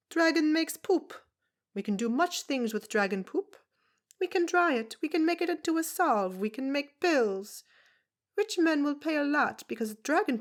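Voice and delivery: put-on a tiny old foreigner scoffing at washerwomen; brusque foreign woman. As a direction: [name] mocking the town's washing, loud and brusque. [name]'s voice held.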